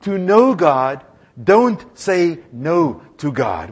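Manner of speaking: slow, firm, every word weighted; slow emphatic motto. Shown as a demonstration. Preacher teaching the congregation a motto, slow and firm. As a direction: spell it out slowly to be memorized. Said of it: "You wanna repeat that?".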